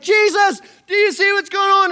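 high, loud panicked plea; frantic shout. Retold or imitated. Disciples yelling at Jesus over the storm, frantic. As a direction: point at the storm in alarm. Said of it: "And they're like".

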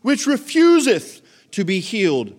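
put-on loud, declaimed King James accent. Preacher repeating the verse for the room, in a hammed-up King James accent. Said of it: "don't you need an accent".

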